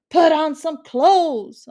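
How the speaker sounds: loud, slow, high scolding yell; cranky aunt yelling from her porch; crabby yell. A narrator's reading. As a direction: bark it at the streaking boy. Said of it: "she yelled in a quite crabby way".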